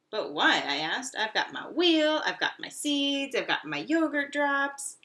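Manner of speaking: high, loud, slow listing; squeaky stubborn hamster. Acted. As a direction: list the treasures proudly. Hamster argues with cat.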